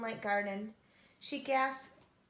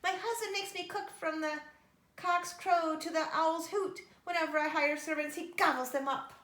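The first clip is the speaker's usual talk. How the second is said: giantess voice; 6 semitones higher, 5 dB louder than usual. A narrator's reading. Giantess complains about her husband.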